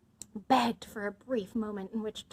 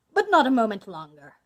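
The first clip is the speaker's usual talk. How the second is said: loud, forceful warning; booming villainous limit; stern ultimatum; 11 dB louder than usual. A narrator's reading. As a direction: close the matter firmly. Bluebeard grants brief delay.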